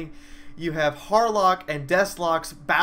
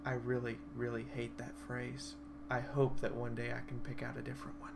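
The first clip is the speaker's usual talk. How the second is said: soft, deflated complaint; quiet grumble; 3 semitones lower, 12 dB quieter than usual. Retold or imitated. Player complains about her own catchphrase, soft and deflated. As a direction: mutter it with mild embarrassment. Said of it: "the first things out of my mouth was".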